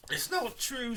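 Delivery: accused man shouting his innocence. A narrator's reading. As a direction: yell the denial. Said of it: "shouted Mister Toby Round".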